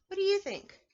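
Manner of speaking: ordinary talk, nothing marked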